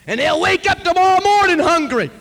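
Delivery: high, loud outcry; anguished shout. Retold or imitated. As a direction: keep shouting at heaven. Preacher crying out to God.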